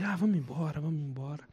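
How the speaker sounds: soft retold nudge to leave; quiet mock urging. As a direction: say it under your breath.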